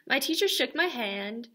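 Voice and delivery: ordinary delivery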